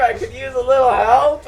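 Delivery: loud urgent call for help. Acted. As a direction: shout it to your friends across the fight. NPC calls out for help.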